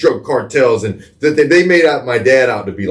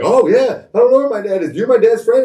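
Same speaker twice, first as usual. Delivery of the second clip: put-on high, eager little-boy voice; be six and trusting, pitched high; boy answering the cop, innocent and high; little kid self; 13 semitones higher than usual. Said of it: "I'm like".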